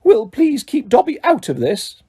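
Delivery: plead, frightened to be named; high frightened Dobby voice; scared mumble. A narrator's reading. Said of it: "Dobby mumbled, looking scared".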